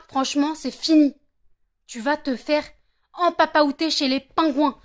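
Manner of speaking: loud, heated rant at a flaky friend; real angry rant. Real, not acted. A woman yelling at her friend who stood her up, furious and loud. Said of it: "Je suis énervée comme pas possible".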